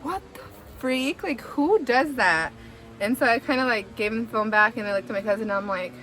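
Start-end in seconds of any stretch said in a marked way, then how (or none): 0.0-2.5 slow, higher-pitched disbelief; incredulous. Retold or imitated. Storyteller reacting to the man who came to watch, incredulous. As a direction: draw it out, appalled at him.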